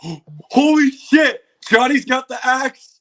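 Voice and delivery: gasp, then a high, loud, stretched holy shit; floored by what he sees; shocked gasp. Real, not acted. Gasping at the trailer to his stream, high shocked shout.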